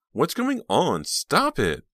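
high, loud alarmed demand; alarmed cry. A narrator's reading. Reva shouts at wrestling boys, alarmed. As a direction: cry out to break it up. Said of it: "she cried in alarm".

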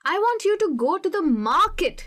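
slow, with market punched; stress on market. Shown as a demonstration. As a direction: hit market at the end. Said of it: "तुम और कहीं नहीं, तुम बाजार में". (market पर ज़ोर, धीमे-धीमे)

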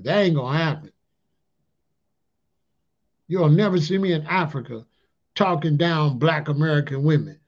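ordinary talk

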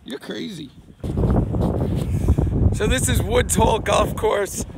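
ordinary talk, nothing marked

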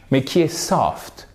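normal talking voice

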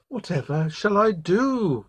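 high, drawn-out storybook wail; mild wail. A narrator's reading. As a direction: wail slowly, in mock despair. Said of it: "wailed the girl".

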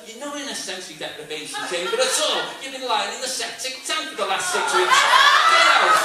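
loud, rising to a high shriek; shouting. Retold or imitated. Woman ordering the comic out of the septic tank, shouting. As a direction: bellow it, ending in a loud shriek. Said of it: "And she goes".

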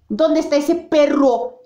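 high, harsh shout of a threat; angry villain. Acted. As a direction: bark it out, hunting someone. Villain hunting down the monster.

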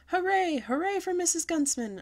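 high, loud cheer for the milk lady; excited shout. A narrator's reading. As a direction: shout hooray like a thrilled child. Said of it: "I shouted at her".

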